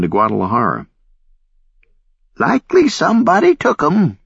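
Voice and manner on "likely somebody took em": put-on old lady voice. A narrator's reading. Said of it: "another old lady said".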